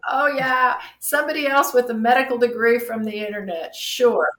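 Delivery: loud, scornful mock agreement; sarcastic eye-roll. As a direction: scoff as an exasperated doctor dismissing a self-diagnosis. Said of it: "like, rolling her eyes and going".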